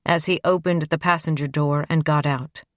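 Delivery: ordinary delivery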